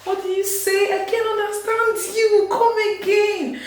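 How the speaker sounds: high mock-bewildered asking to repeat; confused outsider. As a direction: act baffled, squeaking up high. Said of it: "I'll be like".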